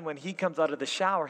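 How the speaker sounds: ordinary delivery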